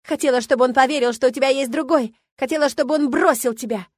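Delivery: loud, high, spiteful tirade; shouted confession. A narrator's reading. Milena tells her rival why she framed her, shouting. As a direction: keep shouting the motive at her. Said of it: "выкрикнула она".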